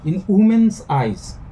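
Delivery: slow, with "woman's" stressed on its first syllable, and "eyes" stressed. Shown as a demonstration. Teacher scanning the second line, slow stress on "woman's" and "eyes". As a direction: lean on the start of "woman's" and on "eyes". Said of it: "first syllable will be stressed".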